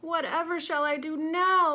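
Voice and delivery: high, loud despairing wail. A narrator's reading. Lady wailing over her lost hat, high and loud. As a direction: wail it to the whole shop. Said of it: "Lady Fitztulip wailed".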